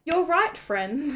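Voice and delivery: normal talking voice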